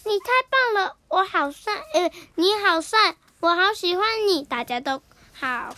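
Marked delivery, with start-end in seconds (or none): 0.1-4.4 louder, higher copied gushing; imitating gushing. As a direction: echo the knight's delight loudly. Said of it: "也学好花骑士说".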